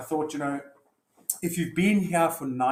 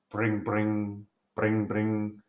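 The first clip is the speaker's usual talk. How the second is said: phone ring imitation; 2 semitones lower, much slower than usual. Imitated phone ring interrupting the fight, voiced lightly. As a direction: mimic a phone ringing, bring bring.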